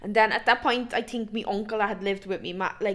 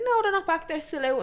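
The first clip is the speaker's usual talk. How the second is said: friend's dad; 6 semitones higher than usual. Retold or imitated. Friend's dad voiced in a story.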